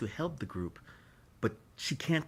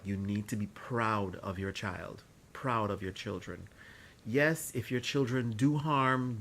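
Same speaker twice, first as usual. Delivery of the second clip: ordinary talk, nothing marked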